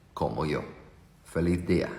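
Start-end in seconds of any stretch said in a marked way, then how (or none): none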